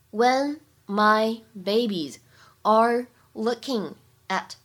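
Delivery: each word apart, slow with pauses. Shown as a demonstration. Teacher drilling the line for learners, slow, word by word. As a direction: read it for learners, one word at a time. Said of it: "teacher repeats line slowly for drill".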